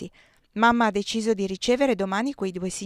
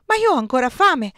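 higher, louder protest; 5 semitones higher, 5 dB louder than usual. A narrator's reading. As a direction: complain like a hungry child.

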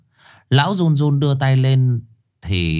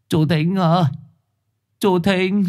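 calling out; 8 semitones higher than usual. A narrator's reading. Uncle calls the coffin maker out at night, calling. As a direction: call to rouse someone indoors. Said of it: "Gọi một hồi".

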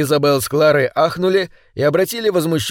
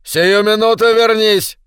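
high, commanding shout; schoolmaster's sharp order, pitch raised; 7 semitones higher, 4 dB louder than usual. A narrator's reading. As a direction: call a runaway pupil back at once. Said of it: "закричал мистер Говард".

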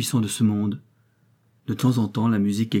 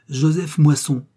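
louder, higher, clear formal proclamation; announcing a name; 5 semitones higher, 6 dB louder, slower than usual. A narrator's reading. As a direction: call the name out to the hall. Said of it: "proféra distinctement le monsieur". (proclamation distincte d'un nom, plus forte)

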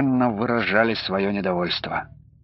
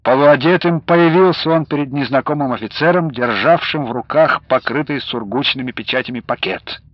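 ordinary delivery, nothing marked

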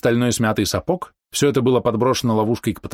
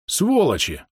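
higher-pitched, mildly hissed insult; hissed curse; 7 semitones higher, slower than usual. A narrator's reading. Bom curses while hurling gear into the trap, hissing. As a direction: spit out the curse without raising volume. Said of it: "прошипел Бом".

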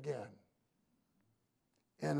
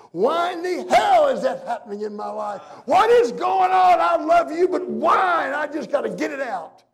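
anguished shout; 12 semitones higher, 20 dB louder than usual. Acted. Preacher acting a believer questioning God at night, anguished shout. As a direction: yell it at God with everything. Acted outcry to God in bed.

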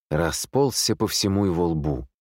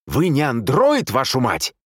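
loud, high, slower angry yell; exasperated shout; 7 semitones higher, 5 dB louder than usual. A narrator's reading. Man losing patience with the nurse, exasperated shout. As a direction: lose patience and curse it out loudly. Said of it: "не выдержал мистер Одли".

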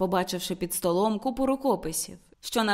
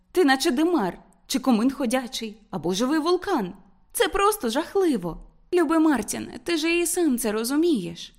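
higher woman's voice, scolding then pleading; reproachful Ruth; 5 semitones higher, 3 dB louder than usual. Acted. Woman urging Martin about his smoking, higher and reproachful. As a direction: lecture him in a higher, dismayed voice.

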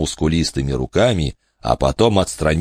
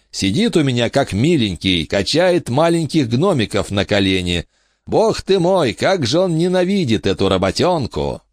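laughing king; 8 semitones higher than usual. A narrator's reading. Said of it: "оглушительно захохотал".

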